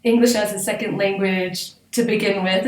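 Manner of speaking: ordinary talk, nothing marked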